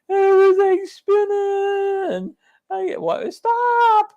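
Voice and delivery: very high, loud, slow wail; mock dizzy drunk. Joking about Bloody Marys, dizzy drunk wail. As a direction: wail like the room is spinning. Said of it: "It's like".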